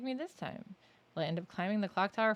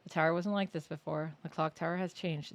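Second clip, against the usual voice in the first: ordinary delivery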